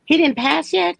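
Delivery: can't believe he's still alive; gasping, high-pitched real shock; shocked disbelief. Real, not acted.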